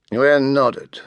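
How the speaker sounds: usual speaking voice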